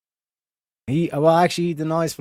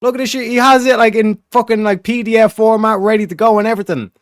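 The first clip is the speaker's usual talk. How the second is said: worked-up rant; 9 semitones higher, 6 dB louder than usual. Real, not acted. Ranting at shared article.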